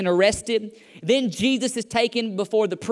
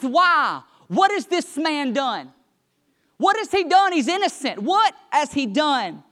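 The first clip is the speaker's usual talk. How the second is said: higher-pitched, emphatic pleading questions; insistent protest; 4 semitones higher than usual. Retold or imitated. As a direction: challenge the crowd, drawing out the questions. Pilate protesting to the crowd.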